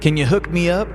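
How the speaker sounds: Roy's text read aloud. A narrator's reading. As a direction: read the text like an eager customer. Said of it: "the text read".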